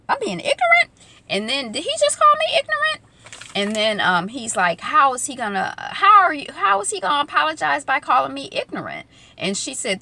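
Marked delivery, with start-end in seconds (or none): 0.1-0.9 very high-pitched offended question; mock incredulity. Imitating Amara answering the insult, high mock incredulity. 1.7-3.0 mock incredulity. Amara questioning what he called her, squeaky mock disbelief. 4.8-9.1 high-pitched, offended questioning; mock indignation. Amara protesting his insulting apology, high mock indignation.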